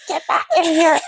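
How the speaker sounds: high mock holler; retold yelling. Owner to dog making for open gate, high mock yell. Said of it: "yelling for him at the top of my lungs".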